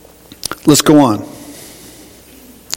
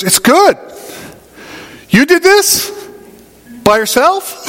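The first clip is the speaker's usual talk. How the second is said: surprised wife; 8 semitones higher, slower than usual. Retold or imitated. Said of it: "she looks at everything and she goes".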